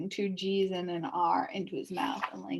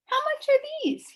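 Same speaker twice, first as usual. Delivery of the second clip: put-on much higher boyish question; young boy asking; 4 dB louder than usual. A narrator's reading. Milo asking the salesman the price, boyish and high. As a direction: ask like a curious little boy. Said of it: "he inquired".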